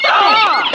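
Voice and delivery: sharp cry of fright as something hits; startled scream. Acted. Jeremy, struck by a diving gull, a startled scream. Diving seagulls attack them.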